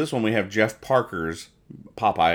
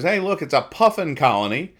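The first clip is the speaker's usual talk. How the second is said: higher, eager pointing-out voice; excited Hagar; 8 semitones higher, 3 dB louder than usual. Retold or imitated. Voicing Hagar as he spots puffins, excited and higher. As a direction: call it out like a delighted tourist. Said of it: "he goes".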